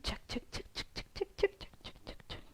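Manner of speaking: quick rhythmic whisper; whispered count. Shown as a demonstration. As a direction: tap out each check under your breath.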